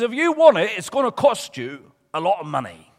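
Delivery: put-on loud comic Bucknell accent, sliding into Tommy Cooper; Mister Weasel. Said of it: "It's getting like Tommy Cooper now".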